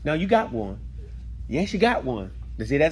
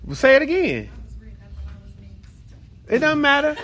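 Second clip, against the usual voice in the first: high, laughing encouragement; 8 semitones higher than usual. Real, not acted.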